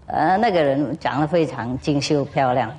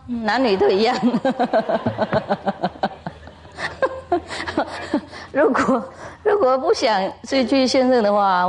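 teasing through laughter; 6 semitones higher than usual. Real, not acted. Teasing advice to the audience.

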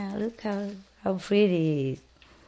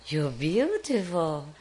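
parrot voice; 3 semitones higher, slower than usual. Retold or imitated. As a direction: mimic the bird repeating its phrase. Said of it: "he told me".

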